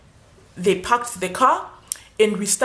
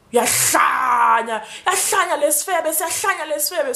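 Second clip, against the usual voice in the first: shouted start, then raised mock abuse; mock insult; 4 semitones higher than usual. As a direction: spit it out as the rude cop. Rude cop insulting her.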